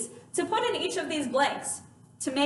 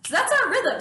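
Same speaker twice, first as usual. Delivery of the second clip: ordinary talk, nothing marked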